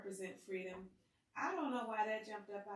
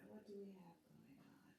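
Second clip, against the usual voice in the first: whisper; 12 dB quieter than usual. Real, not acted. Peeking at the cards.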